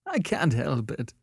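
high, miserable plaint; miserably. A narrator's reading. Annette recovers from a gulp and answers Beverly, miserably. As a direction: plead helplessly, near tears. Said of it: "she said miserably".

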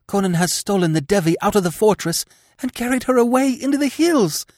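fast, high, breathless rush; blurted in a rush. Acted. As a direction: blurt it all out at once. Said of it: "she blurted out her news in a rush".

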